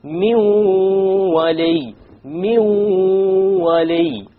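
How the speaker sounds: Min wali chanted high and very slow, noon merged into waw with gunna; recited with gunna. Shown as a demonstration. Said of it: "we drop the noon".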